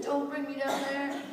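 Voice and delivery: a hint of a child's wailing plea; imitating a crying child. The girl begging not to go down, mild mock wail. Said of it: "She's screaming and she's crying".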